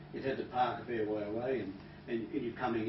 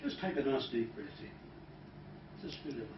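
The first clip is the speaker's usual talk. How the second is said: calm guided breathing; 4 semitones lower, 8 dB quieter than usual. Shown as a demonstration. Hypnotist leading a breath verbally, getting softer. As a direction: ease them into a deep breath. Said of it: "if I say to you verbally".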